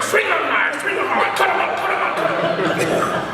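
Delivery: mock trainer barking orders; Mick from Rocky. Teacher pushing the knife form from the corner, as hammed-up Mick. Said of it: "started calling him Mick from Rocky".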